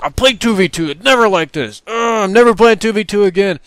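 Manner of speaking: high, loud petulant wail; hammed-up sulky yell about two versus two; J-Hub whining. Teammate swearing off two versus two, high loud whine. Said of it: "You should have heard him screaming like".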